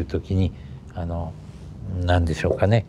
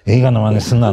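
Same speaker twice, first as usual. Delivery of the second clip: louder Tohoku scolding, 映画の真似すんな slurred to すんな; a teacher's stern telling-off, louder; scolding in dialect; 6 dB louder than usual. Retold or imitated. Remembered teacher scolding the Tokyo boy, loud and stern. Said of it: "なんて言うんですから".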